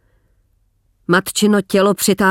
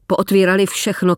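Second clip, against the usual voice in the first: ordinary delivery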